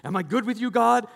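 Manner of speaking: high, eager mock plea; hammed-up anxious people-pleaser; anxious seeking approval. Pastor mimicking a giver anxious for God's approval, high mock plea.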